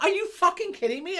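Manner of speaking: high, loud incredulous outburst; retold exasperation. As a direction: protest as if it's the last straw. Retelling reaction to smaller second car.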